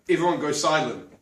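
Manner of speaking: normal talking voice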